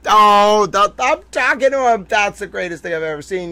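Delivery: high, loud giddy delight; delighted squeal. Real, not acted. Delighted at the emoji.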